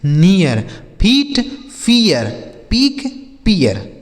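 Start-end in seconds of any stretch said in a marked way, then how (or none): 0.1-2.2 नियर and फियर said with an ee-ya glide, फीट with a long ee; pronunciation demo. Shown as a demonstration. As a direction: say फीट long, then फियर as fee-yar. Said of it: "जैसे हमने यहाँ पढ़ा".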